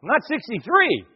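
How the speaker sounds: high-pitched affronted retort; indignant thanks. Retold or imitated. Speaker correcting parents about his age, high indignant retort. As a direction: jump up high, as if offended. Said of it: "And I went".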